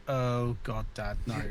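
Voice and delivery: low, soft mock cringe; imitated embarrassed child. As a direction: quietly groan it as an embarrassed kid would.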